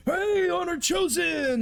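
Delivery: high, slow, excited townsfolk call; excited fan. Acted. Townsfolk hailing the hero with high excited shout.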